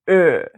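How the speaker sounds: long rounded tense German Ö vowel. Shown as a demonstration. Teacher demonstrating the long tense Ö to learners. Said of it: "It sounds like this".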